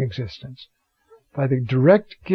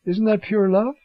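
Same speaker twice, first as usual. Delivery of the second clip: ordinary delivery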